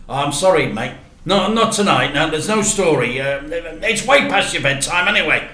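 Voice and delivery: a tired dad brushing off a bedtime story; Dad refusing. Acted.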